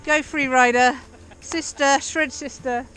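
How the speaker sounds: loud cheer for a rider; cheering shout. Real, not acted. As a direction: shout encouragement down the slope. Cheering on sister from behind.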